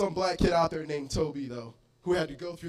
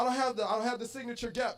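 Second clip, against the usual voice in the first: ordinary talk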